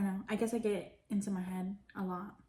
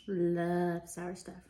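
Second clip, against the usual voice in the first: slow drawn-out; 3 dB louder, much slower than usual. Real, not acted. Slowed, drawn out.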